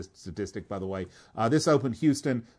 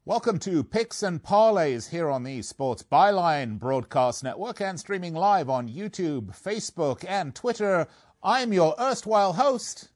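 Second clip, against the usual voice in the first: upbeat announcer voice, pitched higher; radio host intro; 7 semitones higher than usual. Acted. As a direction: open the show like a radio host. Opening of the show as host.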